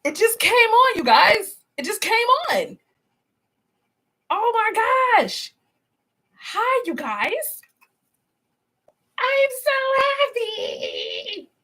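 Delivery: high, thrilled squeals of greeting; excited. Real, not acted. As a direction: gush with delight as the stream finally works. Greets viewers as live starts.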